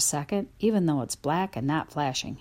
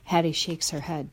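ordinary delivery

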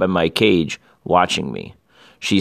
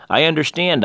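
lightly voiced higher hamster squeak; 7 semitones higher than usual. A narrator's reading. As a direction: pitch it up, a hint of squeak. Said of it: "I squeaked".

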